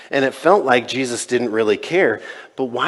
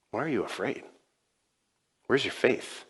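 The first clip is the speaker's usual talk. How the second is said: gentle question; 10 dB quieter than usual. Retold or imitated.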